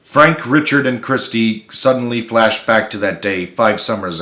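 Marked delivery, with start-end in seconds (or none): none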